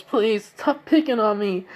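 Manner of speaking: high, slow mock-crying plea; tearful pleading. Crying girl begging her bullies, high tearful plea. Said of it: "she's crying".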